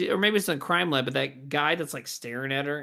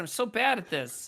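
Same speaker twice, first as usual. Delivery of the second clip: normal talking voice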